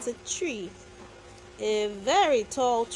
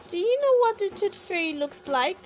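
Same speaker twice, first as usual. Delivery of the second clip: eager question; 7 semitones higher, much faster than usual. A narrator's reading.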